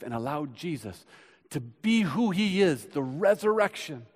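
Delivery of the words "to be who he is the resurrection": louder, higher lift; rising preacher call; exhortation. Acted. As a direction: ring it out. Sermon surge.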